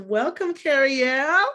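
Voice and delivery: loud, high, rising welcome; excited welcome. Real, not acted. Host welcomes Carriel onto the podcast, loud and excited. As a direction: call the guest in with joy. Host calls in her guest.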